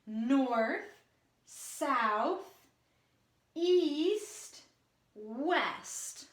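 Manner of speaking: each word stretched out, with a pause after; drawn-out teacher's roll call, rising until a falling last word; say-along pointing drill. Shown as a demonstration. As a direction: lead children slowly through each direction, word by word. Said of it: "point with me".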